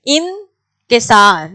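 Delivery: انکسار said very slowly, each sound drawn out; careful word demo. Shown as a demonstration. Teacher modelling the word for students, slow and careful. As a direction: spell out انکسار slowly for the class. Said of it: "انکساری کے ساتھ کہتے ہیں".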